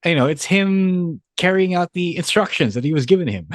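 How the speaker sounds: usual speaking voice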